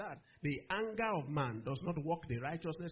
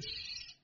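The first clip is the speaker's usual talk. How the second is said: long unvoiced shush; hushing; 6 dB quieter than usual. God silencing the young believer's excuse, long shush. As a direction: hush the excuse firmly. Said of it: "God said".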